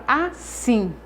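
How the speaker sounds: "assim" drawn out, strong on "sim"; slow teacher demo of "assim", stressing the final "sim". Shown as a demonstration. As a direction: stretch "assim" and land on "sim". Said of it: "o sim é a sílaba tônica".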